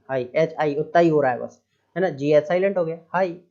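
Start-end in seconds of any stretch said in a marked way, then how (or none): none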